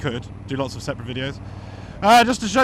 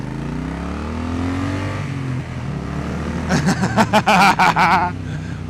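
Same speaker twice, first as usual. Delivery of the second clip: long deep laugh. Real, not acted. Laughing at his own accent joke.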